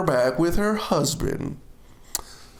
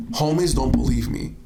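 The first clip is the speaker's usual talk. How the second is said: normal talking voice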